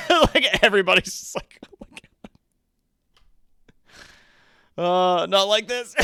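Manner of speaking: loud, breaking into laughter; laughing. Real, not acted. Streamer losing it over the reading, laughing. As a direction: crack up at the scene.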